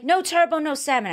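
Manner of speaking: "No turbo, no salmon" as the English chef barking orders; English chef accent. Retold or imitated. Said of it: "Was that your English accent".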